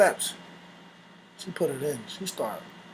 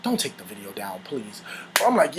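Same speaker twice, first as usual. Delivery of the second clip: ordinary talk, nothing marked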